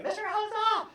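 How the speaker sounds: high call of a name; screaming name. Retold or imitated. Student screaming her teacher's name, pitched high. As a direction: call out to get his attention. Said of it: "So she's screaming".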